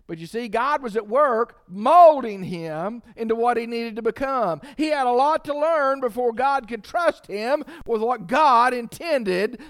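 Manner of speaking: loud, high, driving sermon voice; full-force preaching. Acted. Preacher warning the church about bitterness, loud and high. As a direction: preach it hard to the congregation.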